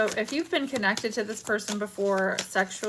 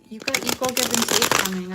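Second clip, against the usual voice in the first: normal talking voice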